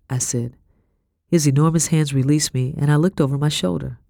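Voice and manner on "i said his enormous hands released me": ordinary delivery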